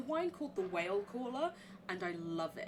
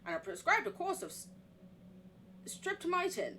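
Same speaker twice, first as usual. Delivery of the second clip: document read-aloud; 8 semitones higher than usual. Acted. Reading the sanatorium report in a put-on American accent.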